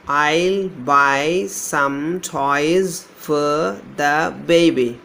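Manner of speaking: very slow, drawing out each word of the sentence; slow dictation. Shown as a demonstration. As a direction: stretch every word so the diphthongs can be heard. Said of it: "Here we have a diphthong".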